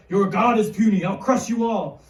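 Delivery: loud, menacing giant's taunt; Goliath. Acted. Giant taking his taunts a step further, loud boast. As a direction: hammer out the threat like a bully. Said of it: "loud voice".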